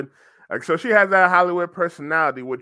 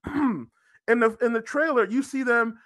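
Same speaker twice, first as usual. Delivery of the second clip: ordinary talk, nothing marked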